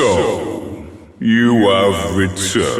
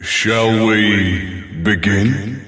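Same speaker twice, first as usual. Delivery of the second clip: ordinary talk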